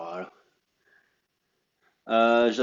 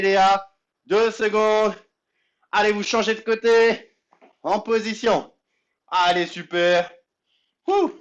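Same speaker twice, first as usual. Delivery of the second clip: fitness coach; 7 semitones higher than usual. Acted. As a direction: pump up the class while exercising. Coach leading a live workout.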